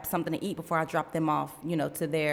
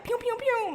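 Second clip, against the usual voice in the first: high-pitched pew-pew noises; cartoonish high shooting sounds; imitated gunshots. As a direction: playfully mimic rapid gunfire. Imitates being shot down.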